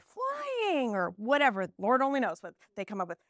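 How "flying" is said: put-on child answering. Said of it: "And they might say".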